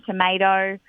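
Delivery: "tomato" said tuh-MAY-doh; American long "ay" in "tomato"; American pronunciation. Retold or imitated. Daughter telling Mum about lunch, American-style "tomato". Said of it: "Mum's like, "Tomato, huh?".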